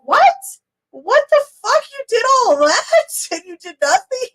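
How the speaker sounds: high, squealing what the fuck; shocked disbelief. Retold or imitated. Reacting to Melissa's day, high shocked squeal. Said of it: "I was like".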